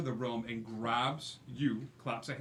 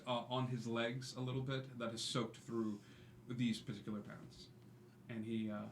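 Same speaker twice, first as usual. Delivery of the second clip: ordinary talk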